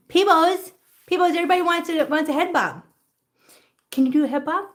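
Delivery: high-pitched pet-coaxing voice; sing-out call to a beloved pet. Real, not acted.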